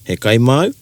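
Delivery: "He kai mau?" rising at the end; "mau" lifted English-style; rising question tune. Shown as a demonstration. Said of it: "Instead of".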